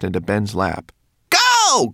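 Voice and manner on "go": very high, loud yell; furious cry. A narrator's reading. Twigleg orders the elves away, furious high cry. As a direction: yell it out in a high-pitched fury. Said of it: "cried the mannequin, shaking his little fists in fury".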